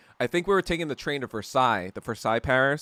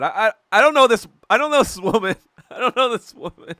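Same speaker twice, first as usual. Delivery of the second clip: higher, louder, rushed flustered denial; mock panic; 8 semitones higher, 8 dB louder than usual. Pretending to the security guard, panicked stammering denial. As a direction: fake a panicked stammer to disown her.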